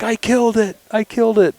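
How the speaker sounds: high, loud, excited boy imitation; retold freaking out. Son tells dad he got the bear, imitated freaking out. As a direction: blurt it out way up high, overexcited. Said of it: "He's, like, freaking out".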